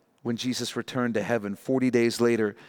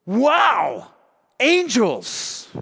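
high, amazed exclamation of wonder; awestruck, pitched way up; awed wow; 9 semitones higher, 4 dB louder, much slower than usual. Acted. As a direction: gasp it out like seeing angels. Awed cry at seeing angels.

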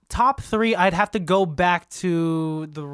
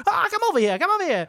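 much higher, scolding mom call; mother calling; 12 semitones higher, faster than usual. Acted. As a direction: call the runaway kids back. Moms chasing the fleeing children.

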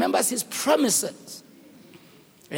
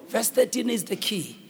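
ordinary talk, nothing marked

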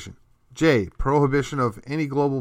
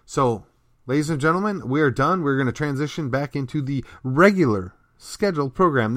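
ordinary delivery

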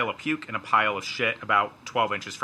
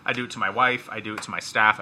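ordinary delivery

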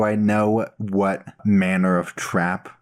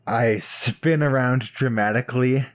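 usual speaking voice

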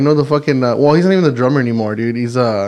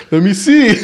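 put-on higher, goofy drunk voice; mock drunk guitarist; 7 semitones higher than usual. Friend imitates the drunk guitarist asking to look, goofy drunk voice. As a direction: ham up a drunk buddy leaning in.

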